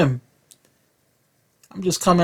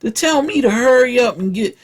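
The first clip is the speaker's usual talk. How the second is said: higher, heated mock comeback; mock outraged retort; 7 semitones higher than usual. Comic voicing his teenage self's comeback to the teacher, higher and heated. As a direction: fire back at the teacher, outraged. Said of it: "I'm like".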